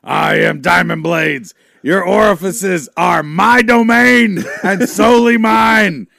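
slow booming heel wrestler, shouted; wrestler promo shout. Acted. Heel wrestler to the crowd, booming slow shout. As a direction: bellow it like a heel cutting a promo.

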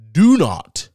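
high, loud DO NOT; loud warning. Acted. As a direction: shout the warning out. Urgent warning repeated.

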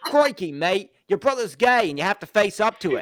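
loud mock Australian, crikey mate; Aussie bloke. Aussie in an imagined spin-off scolding his goomba partner, in a loud mock Australian accent. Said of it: "Aussie and the Goomba".

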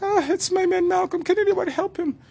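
very high, loud mock woman's voice. Woman begging the flight attendant, high mock voice. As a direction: squeal it like a fussy wife.

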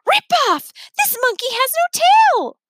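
high, loud shriek through a door; yell. Acted. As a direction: yell it from behind the door, outraged. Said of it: "Jane Yancey yell from the other side".

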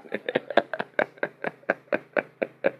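soft, voiceless chuckle; long wheeze of quiet laughter; real laugh. Real, not acted. Preacher tickled by his own old-fashioned example, laughing softly.

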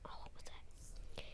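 whispered aside to herself; whisper to self. Real, not acted. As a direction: breathe it out unvoiced, thinking. Pausing to remember mid-story.

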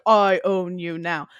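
loud, slow mock domination; mock galactic ruler. Imagining herself ruling the planet, mock-imperious. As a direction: lay claim slowly and loudly, hammed up. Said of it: "I wouldn't necessarily be like".

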